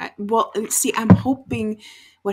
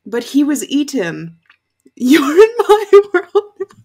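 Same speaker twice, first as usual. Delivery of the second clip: quoting the line, laughing and louder; laughing imitation; 9 semitones higher, 10 dB louder than usual. Fan quoting a favorite TV line, laughing. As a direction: repeat the principal's line through laughter. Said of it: "He just said".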